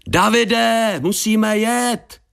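high, stretched-out urgent call. A narrator's reading. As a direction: shout high toward someone out of sight. Shouting again toward the house. (naléhavé volání vysokým, protáhlým hlasem)

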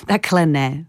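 amused, with a short laugh first; laugh, then gently correct; laughing through a reply; laughing correction. A narrator's reading. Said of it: "smála se Věra".

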